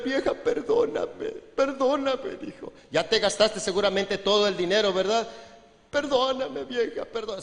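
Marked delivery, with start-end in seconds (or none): 0.0-2.3 high, whispery hammed-up sobbing plea; mock crying plea. Drunk husband begs wife's pardon, mock tearful whisper. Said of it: "Se pone a llorar". 5.9-7.4 high, whispery hammed-up sobbing plea; mock crying plea. Drunk husband begs wife's pardon, mock tearful whisper. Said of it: "Se pone a llorar el ingrato".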